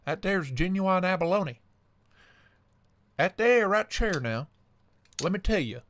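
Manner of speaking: a hint of a hammed-up Southern salesman; southern accent. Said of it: "stuck in my southern accent".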